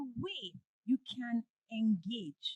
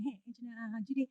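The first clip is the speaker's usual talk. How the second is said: humble asking; 6 dB quieter than usual. Retold or imitated. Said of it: "Teach me".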